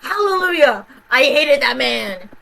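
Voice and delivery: loud, high hallelujah; gleeful shout of relief; triumphant cheer. Real, not acted. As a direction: cheer his death. Celebrating the sheriff's death.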